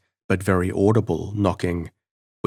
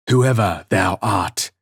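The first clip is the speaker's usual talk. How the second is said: slow, louder cry of defiance; cried out; 3 dB louder than usual. A narrator's reading. Prisoner crying out at the knocking voice, slow defiant cry. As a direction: cry it out slowly at the unseen voice. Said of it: "cried I".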